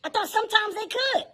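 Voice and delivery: high, mocking voice of the older lifeguard; mocking older guy. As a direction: tease him, high and sly. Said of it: "He go".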